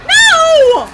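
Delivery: frantic yelling at a chaser in the game; real panicked shout. Real, not acted. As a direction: scream at him to stay off the stairs. Yelling at fat man chasing.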